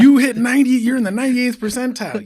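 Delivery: very high, loud mock outrage; hammed-up, squealed protest; incredulous exclamation. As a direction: shout in disbelief at a perfectionist. Acted incredulous reply to perfectionist.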